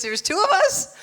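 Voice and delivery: high, squeaky incredulous question; laughing, amazed question; mock astonishment. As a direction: shoot the pitch way up in disbelief. Ali reacting to the boast.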